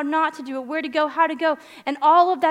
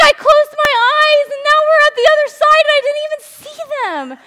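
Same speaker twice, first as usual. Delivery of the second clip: put-on excited child; 14 dB louder than usual. Daughter's breathless excited report.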